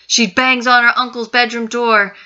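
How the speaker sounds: plain reading voice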